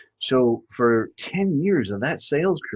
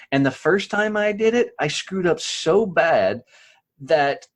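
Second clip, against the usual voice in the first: emphatic retelling; 7 semitones higher, 5 dB louder than usual. Retold or imitated. Retelling first scary live call.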